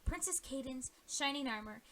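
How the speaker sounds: usual speaking voice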